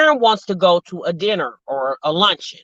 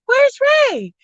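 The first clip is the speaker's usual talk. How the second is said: Karen searching. Retold or imitated. Wife looking for husband at the party, high frantic calling. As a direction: call out like a wife hunting her husband. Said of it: "she was like".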